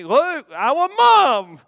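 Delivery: put-on loud, high crying kid; imitating a son in tears; bawling child. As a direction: wail for mom like an upset toddler. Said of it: "just crying".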